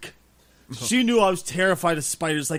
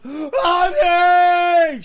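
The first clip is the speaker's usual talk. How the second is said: long, high, loud call for help; 12 semitones higher, 8 dB louder than usual. Retold or imitated. Scared of a spider, calling the wife, loud drawn-out call. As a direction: yell for her from the shower. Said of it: "I called my ex-wife".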